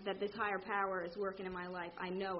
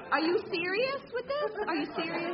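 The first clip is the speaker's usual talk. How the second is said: much higher, louder, laughing disbelief; incredulous disbelief; 8 semitones higher, 6 dB louder than usual. Retold or imitated. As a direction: laugh out how ridiculous this is. Reacting to Brandy's obnoxious heart.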